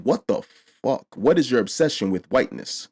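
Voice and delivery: usual speaking voice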